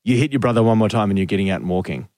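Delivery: low, lightly voiced stern father; dad as Maximus. Host recalling dad's car threat, low stern-dad imitation. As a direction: give a low, mock parental threat. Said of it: "he sounds like Maximus".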